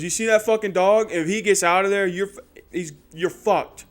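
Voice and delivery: loud, heated warning building to a yell; angry homeowner. Retold or imitated. Homeowner ordering a kid off his yard, angry shouting.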